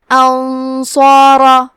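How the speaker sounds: انصارا chanted slowly with a thick, heavy ر and long alif. Shown as a demonstration. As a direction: draw out انصارا, keeping ص, alif and ر full-mouthed. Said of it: "را کو موٹی آواز میں پڑھنا ہے". (انصارا کی را موٹی آواز میں، ٹھہر کر)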